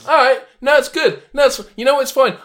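high, loud, wounded protest; hammed-up hurt pride; mock offended. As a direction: insist it's fine while clearly put out. Mock hurt after being called unfunny.

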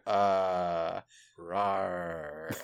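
laid-back monster voice with lazy rawr; casual monster. Pretend. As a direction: chill giant monster. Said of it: "the giant monster is like".

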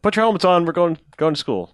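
lightly acted parental call; calling to kids. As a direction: round up the kids for school. Voicing dad rousing his kids.

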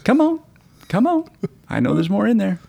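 high, squeezed pleading; playful high-pitched urging; mock coaxing. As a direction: coax it out in a tiny high voice. Coaxing out the last drops.

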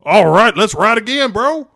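high, loud, stretched surfer bro; hyped extreme-sports bro; dude bro voice. Acted. Aquaman voiced in film recap.

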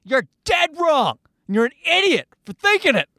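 high, emphatic comic rebuke; mock scolding. Telling off the listener for guessing wrong, mock scolding.

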